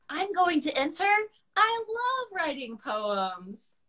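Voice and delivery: the dragon can't wait to enter; high eager dragon declaring. Acted.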